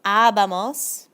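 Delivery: Habamos drawn out slowly, each syllable savoured; fun to say. Shown as a demonstration. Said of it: "Nosotros is fun to say".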